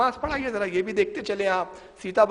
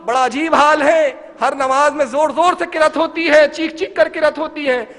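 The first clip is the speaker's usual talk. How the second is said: complaining loudly; 6 semitones higher, 8 dB louder than usual. Retold or imitated. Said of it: "شکایت کرتے،".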